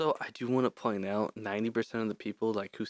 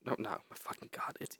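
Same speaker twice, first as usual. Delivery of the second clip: whisper; 13 dB quieter than usual. Real, not acted. Whispered self-correction after slip.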